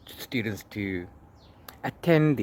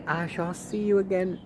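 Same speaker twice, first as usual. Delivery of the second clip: playful goodbye; 8 semitones higher than usual. Real, not acted. Playful sign-off to viewers.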